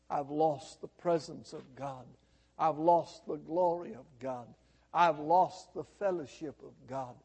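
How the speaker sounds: low, softened voice of loss; mournful lament. Acted. Preacher voices Adam losing God, in subdued lament. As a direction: grieve each loss quietly.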